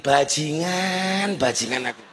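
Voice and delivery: slow, drawn-out curse under the voice; retold inner curse. Speaker recalls cursing inwardly at the auction, drawn-out muted curse. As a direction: stretch the first word, drop low on the repeat. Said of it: "Saya batin".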